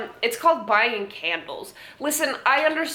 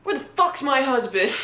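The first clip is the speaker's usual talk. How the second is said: Rhea Ripley; 6 semitones higher than usual. Pretend. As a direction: play a ripped wrestler blowing up. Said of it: "Rhea Ripley? Um, I just imagine her reading this".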